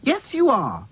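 high-pitched bossy comeback; insistent retort. A narrator's reading. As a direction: contradict in a high, bickering voice. Childish quarrel.

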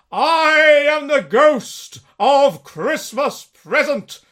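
loud, high, booming spirit voice; booming ghost. Acted. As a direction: boom a giant's welcome. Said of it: "said the spirit".

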